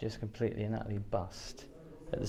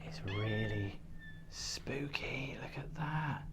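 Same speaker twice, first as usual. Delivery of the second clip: whispering; 8 dB quieter, much slower than usual. Real, not acted. Creepy fire exit, hushed.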